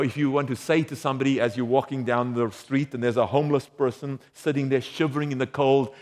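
ordinary talk

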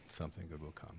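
repeated almost in a whisper; soft echo. Shown as a demonstration. Preacher echoing his promise to the congregation, very softly. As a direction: let the echo fall away softly.

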